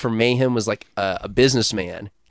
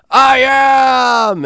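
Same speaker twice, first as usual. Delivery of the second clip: high shouted I AM; mock metal singer roar; singer hyping crowd; 13 semitones higher, 5 dB louder than usual. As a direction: yell it like a metal frontman to the crowd. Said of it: "He goes".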